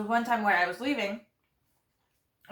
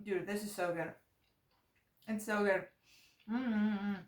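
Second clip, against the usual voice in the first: mouth full; 7 dB quieter, slower than usual. Real, not acted. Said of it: "Talking and eating is so bad".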